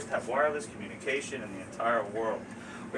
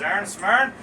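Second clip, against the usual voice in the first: higher, louder Newfoundland dialect, rising on "smern"; Newfoundland fishing captain; 7 semitones higher, 8 dB louder than usual. Shown as a demonstration. One fishing captain asks the other, thick Newfoundland dialect. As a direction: call a slurred Newfoundland question across the water. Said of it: "One fishing vessel captain looks over at the other".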